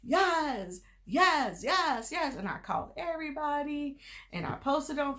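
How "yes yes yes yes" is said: higher, bouncing string of happy yeses; excited yeses. Retold or imitated. Retelling her reaction to passing, higher excited yeses. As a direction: chant yes like you just won. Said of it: "I was so excited".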